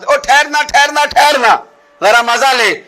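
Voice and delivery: loud call of wait, wait; mock call of wait from behind; calling out to stop. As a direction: call after someone to make them stop. Caller stops someone from behind.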